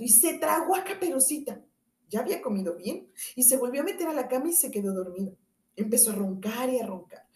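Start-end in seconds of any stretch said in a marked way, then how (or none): none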